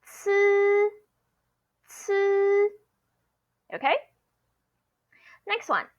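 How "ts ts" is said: "ts" with a buzzed vowel held high and level; first tone. Shown as a demonstration. Teacher showing how C and C I sound, long high level "ts". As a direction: stretch "ts" on a steady high pitch. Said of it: "first tone".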